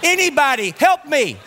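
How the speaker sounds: high-pitched cries for help; mock panicked scream. Dad reenacting his parking garage meltdown, mock panicked screaming. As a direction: holler for help, hammed up. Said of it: "screaming at the top of my voice".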